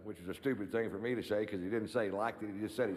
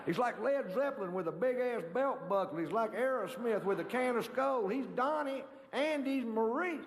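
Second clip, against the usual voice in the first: high, pitch climbing, a devotee gushing; excited fan rant; 10 semitones higher than usual. Retold or imitated. Comic channelling the [name] fan, gushing high and rising.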